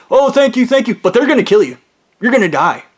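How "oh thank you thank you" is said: high gushing thank-you. Retold or imitated. Grateful callers gushing thanks in a high voice. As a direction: fawn over him, pitched up. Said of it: "They start like".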